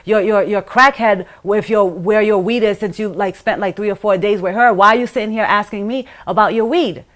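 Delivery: loud, indignant retold tirade; very upset. Woman retelling her answer about his weed, loudly upset. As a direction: re-enact firing angry questions back at him. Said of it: "I became very upset at that time".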